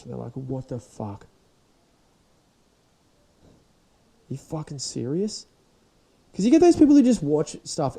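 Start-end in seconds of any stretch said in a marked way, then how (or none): 0.3-5.5 shocked friend. Retold or imitated. Friends shocked at non-movie watcher.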